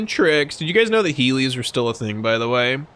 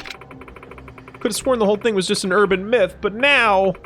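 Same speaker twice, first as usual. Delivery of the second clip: higher, fast, lightly voiced frightened hostess; scared hostess; 7 semitones higher than usual. Streamer voices the frightened hostess pleading to the heroes, higher and fast. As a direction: rush it out like she just escaped an attack.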